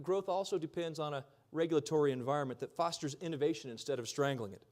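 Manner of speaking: ordinary talk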